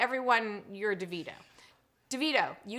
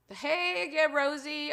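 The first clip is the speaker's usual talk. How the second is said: ordinary delivery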